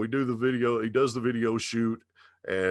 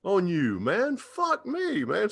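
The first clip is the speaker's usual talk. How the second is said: pitched way up, mock-grumbling; high mock Dime voice; 13 semitones higher, slower than usual. Dime grumbling at being caught out, high mock voice. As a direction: ham up the busted complaint, voice high.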